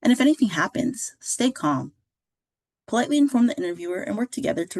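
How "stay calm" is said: normal talking voice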